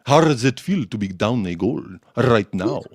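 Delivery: deep, mock-interviewer put-on voice; Don Hassam impression. Caller needling someone a goal down, deep imitation voice. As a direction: imitate a gloating caller, pitched far down. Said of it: "that's not my Makarov shitty Russian impre".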